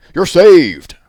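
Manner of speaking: the ox roaring to the rescue. Acted. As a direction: blast it out like a huge ox. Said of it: "roared the ox".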